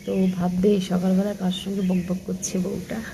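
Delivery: hushed voice, keeping it down; speaking softly. Real, not acted. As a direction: talk quietly so nobody hears. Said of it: "তার জন্য একটু আস্তে আস্তে কথাবার্তা বলছি".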